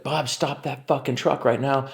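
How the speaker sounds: high mock yell without volume; retold shouted order. Retold order to stop, repeated.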